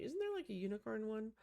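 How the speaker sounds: normal talking voice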